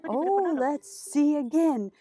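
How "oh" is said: usual speaking voice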